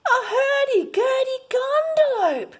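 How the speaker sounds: high, loud delighted shout with a laugh; gleeful children's shout. Acted. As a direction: cheer it out like a crowd of kids. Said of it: "they all shouted".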